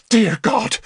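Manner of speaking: high, startled gasp of words; horrified exclamation. A narrator's reading. Bishop blanching at sight of his enemy, high horrified cry. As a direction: recoil in horror, pitch leaping.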